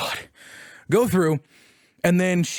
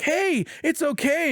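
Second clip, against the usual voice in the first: gentle reassurance; 10 semitones higher, slower than usual. Retold or imitated. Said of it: "Dina's like".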